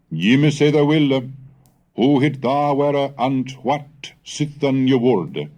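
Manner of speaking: fiery sermon. Acted. Wulfstan warning the English of the world's end, fiery preaching. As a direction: proclaim doom like a bishop in the pulpit.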